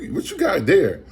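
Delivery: high, nosy mock lady voice with a laugh. Stranger at the thrift store quizzing him, high mock voice.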